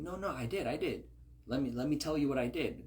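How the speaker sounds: high, hurried excuse-making; protesting denial. Retold or imitated. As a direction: talk over him, eager to explain. Saul paraphrased protesting.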